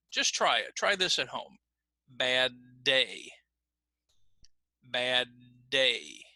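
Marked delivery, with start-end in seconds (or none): 2.1-6.2 BAD DAY with the first D held unreleased, finished on DAY; stop on the D. Shown as a demonstration. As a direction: hold the d of "Bad", then release it into "day". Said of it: "stop on the D in the first word".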